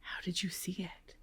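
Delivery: soft unvoiced whisper. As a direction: lean in and whisper the question. Teacher whispers question to class.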